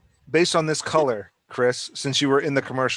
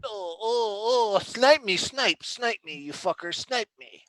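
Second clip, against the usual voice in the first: high, whiny play-acted gamer; mock whining gamer; 8 semitones higher, 3 dB quieter, slower than usual. Playing a gamer under sniper fire, mock whining. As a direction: whine it high, like a losing gamer.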